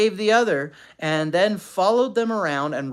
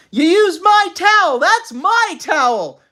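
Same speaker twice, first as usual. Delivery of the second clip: high, loud, outraged cry; quarreling; 14 semitones higher, 10 dB louder than usual. A narrator's reading. Mother accusing father over her towel, quarreling loudly. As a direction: shriek it with stress on "my".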